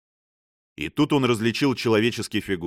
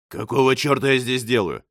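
loud question; 3 semitones higher than usual. A narrator's reading. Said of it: "спросил он громко".

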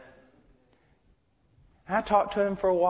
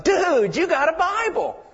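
put-on surfer dude kid; 7 semitones higher than usual. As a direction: imitate the young waiter's stoked "Dude!". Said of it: "I said that pretty good, didn't I?".

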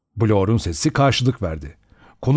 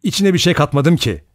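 higher, testy protest; angry; 6 semitones higher, 3 dB louder than usual. A narrator's reading. Blore denying he put anything in it, mildly angry. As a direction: protest your innocence crossly. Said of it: "Blor öfkeyle".

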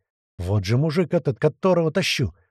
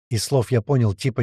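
ordinary delivery, nothing marked

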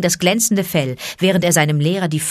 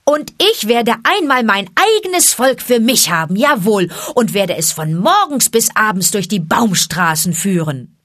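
put-on loud, raised boy's crowing; full-throated boast; 4 semitones higher, 5 dB louder than usual. A narrator's reading. Boy demanding his friends' attention, full-throated boast. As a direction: call it out proudly at full voice. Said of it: "rief er aus vollem Halse".

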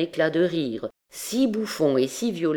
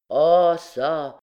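a loud exclamation of mock dismay; outburst; 5 dB louder than usual. Student cries out at the alchemist's lab, loudly. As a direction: throw it at your brother. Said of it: "cria l'écolier".

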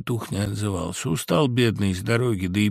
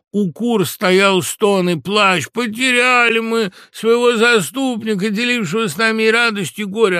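wailing lament; 12 semitones higher, 8 dB louder than usual. A narrator's reading. Fox describing the grieving hens, theatrical wailing lament. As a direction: lament theatrically, stretching the words.

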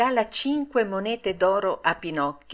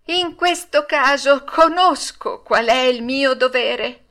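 high, proud, ringing declaration; loud heroic speech; 6 semitones higher, 5 dB louder than usual. A narrator's reading. Pinocchio offers to die for Arlecchino, loud and heroic. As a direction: declaim it like a hero, voice raised. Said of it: "pronunciate con voce alta e con accento eroico".

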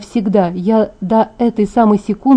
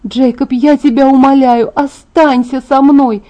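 loud, high, drawn-out begging; desperate pleading; 5 semitones higher, 5 dB louder than usual. A narrator's reading. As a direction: implore him with everything you have. Heroine begs him to stay. (отчаянная, громкая мольба)

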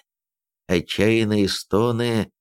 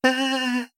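high piercing woman's scream; shrill scream. A narrator's reading. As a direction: scream out in terror. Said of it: "истошные женские крики".

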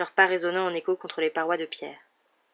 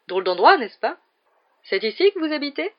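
blithe, high-rising questions; cheerful Lockhart; 8 semitones higher, 6 dB louder than usual. A narrator's reading. Lockhart, memory lost, asks about this odd place, blithely cheerful. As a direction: ask it like a happily clueless visitor. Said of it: "Lockhart le regarda avec bonne humeur".

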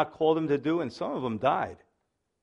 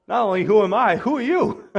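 high, laughing amazement; laughing delight; 5 semitones higher, 5 dB louder than usual. Real, not acted. Preacher laughing to the congregation at Moses' question, high and amused.

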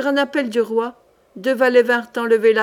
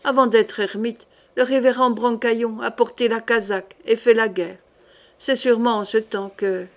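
usual speaking voice

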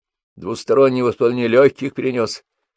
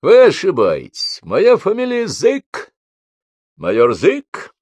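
loud officer voice; 10 semitones higher, 5 dB louder, slower than usual. A narrator's reading. Major announces his name.

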